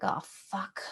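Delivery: a soft, hushed mock curse under the breath. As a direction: mutter it as if caught out. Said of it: "And I'm like".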